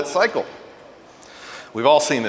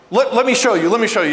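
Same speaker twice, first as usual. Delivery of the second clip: high, insistent parent taking over; acted pushy eagerness to fix it; impatient parent; 7 semitones higher, 4 dB louder, faster than usual. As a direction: cut in as a flustered parent. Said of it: "a parent's instinct is to be like".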